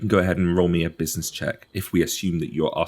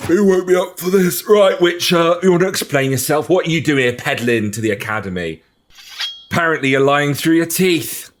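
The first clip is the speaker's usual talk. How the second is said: high, loud, gruff guard shouting at intruders; grumpy guard; 8 semitones higher, 4 dB louder than usual. Acted. Guard woken for nothing confronts the Witcher, loud and high. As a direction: bark like a guard dragged out of bed.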